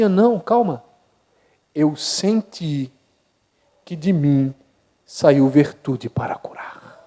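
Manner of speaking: Jesus speaks slowly and softly, awed; hushed Jesus. Acted. Jesus calmly telling his disciples he felt power leave him, hushed and slow. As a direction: let it drop to a slow hush. Jesus voiced answering the crowd.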